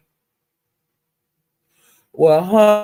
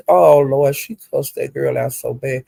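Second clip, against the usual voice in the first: normal talking voice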